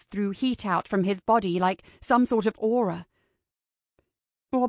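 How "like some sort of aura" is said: ordinary delivery, nothing marked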